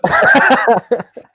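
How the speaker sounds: a loud, high burst of laughter. Real, not acted. Host cracking up after joking that was an intervention, with a loud laugh. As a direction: laugh out loud at his own joke.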